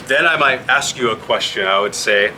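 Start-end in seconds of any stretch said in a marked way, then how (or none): none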